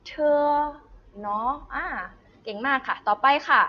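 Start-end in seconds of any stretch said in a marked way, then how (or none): 0.1-0.4 เธอ held long on a high level pitch; demonstrating a pinyin sound. Shown as a demonstration. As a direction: say เธอ as one loud, high, sustained model sound. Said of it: "ออกเสียงว่า".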